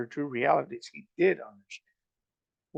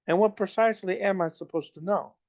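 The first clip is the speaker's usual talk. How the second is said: higher, indignant princess demanding an answer; growing anger; 7 semitones higher than usual. A narrator's reading. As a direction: let the annoyance rise. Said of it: "Anger was growing in her voice".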